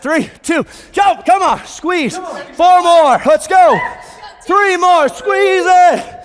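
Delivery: high, loud coach yelling the reps; shouting. Real, not acted. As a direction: yell them through the last reps.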